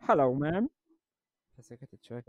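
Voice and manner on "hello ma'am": higher American supervisor voice; changed voice. Retold or imitated. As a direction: sound like someone else entirely. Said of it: "مغير صوتي".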